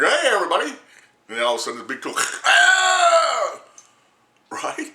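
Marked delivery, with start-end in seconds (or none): 0.0-0.7 high, loud "hey everybody"; mock cheerful greeting. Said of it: "he just said". 2.4-3.6 high loud mock yell; mock shout. Mocked doctor shout.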